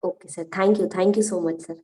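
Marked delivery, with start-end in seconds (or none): none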